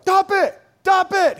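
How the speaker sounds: put-on loud, high toddler yell; sharp, high mock tantrum shouts; protesting toddler. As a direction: imitate a toddler refusing food. Toddler son refusing to be fed.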